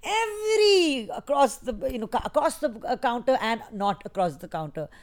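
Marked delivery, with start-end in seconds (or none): 0.0-1.1 EVERY stretched long, high and loud; emphatic EVERY. Real, not acted. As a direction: belt out a drawn-out EVERY for exaggeration. Exaggerates size of medicine haul.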